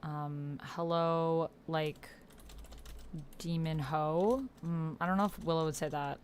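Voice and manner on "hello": normal talking voice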